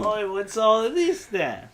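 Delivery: put-on hammy Cockney accent, slow and high; bad Cockney accent. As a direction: play a mock London bobby, Cockney. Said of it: "my British accent because it's a really bad Cockney".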